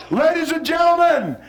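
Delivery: loud, high, slow showman's call; booming stage introduction; grand announcer. Acted. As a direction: announce them like a ringmaster.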